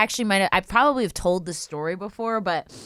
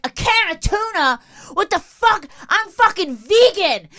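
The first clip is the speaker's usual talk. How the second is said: high, loud outraged squawk; irate homeless woman; 12 semitones higher, 4 dB louder than usual. Retold or imitated. Homeless woman scolding the giver over tuna, screeching. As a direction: rant at the gift like it's an insult. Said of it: "she's like".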